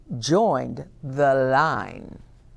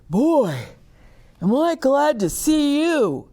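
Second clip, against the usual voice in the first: relieved, high bear greeting; 9 semitones higher than usual. Acted. Bear greets Milo after popping out.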